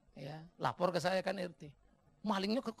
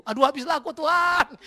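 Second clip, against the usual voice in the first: loud, high mock despair; panik; 10 semitones higher, 13 dB louder than usual. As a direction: wail in comic dread of being found out. Membayangkan dirinya ketahuan oleh jemaat. (mengeluh panik, keras dan tinggi)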